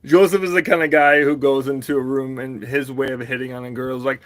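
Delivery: usual speaking voice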